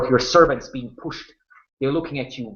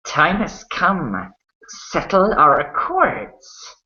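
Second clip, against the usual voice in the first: high, gloating, slow villain voice; sadistic half-elf; 6 semitones higher, slower than usual. Acted. Half-elf gloating over his bound prisoners, slow sadistic relish. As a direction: savour every word like a cruel victor. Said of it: "Hendriel the half-elf".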